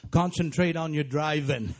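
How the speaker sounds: put-on slow deadpan in a Maine accent; slow, blunt Maine admonishment; Maine humor voice. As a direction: say it like an unhurried Maine local. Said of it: "that's how you know it's Maine humor".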